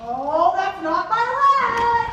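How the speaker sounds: loud, slow outraged protest; a huffy, offended cry; indignant surprise. Acted. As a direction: snap back at the clumsy grab. Queen's leg grabbed by mistake.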